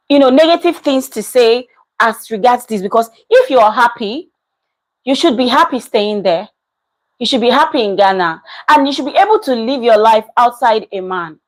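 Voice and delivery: ordinary talk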